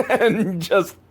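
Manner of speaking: laughing. Real, not acted. Reviewer cracking up at the fly on the camera, laughing. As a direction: talk through a laugh.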